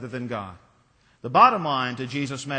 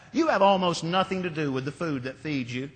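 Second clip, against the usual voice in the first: ordinary talk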